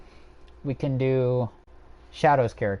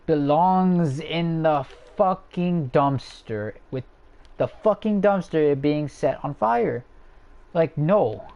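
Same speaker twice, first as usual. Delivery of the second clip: slow, higher-pitched condemnation; emphatic disgust; 4 semitones higher, 3 dB louder, much slower than usual. Real, not acted. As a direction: drag out each word of the angry verdict. Emphatic rant against a disliked character.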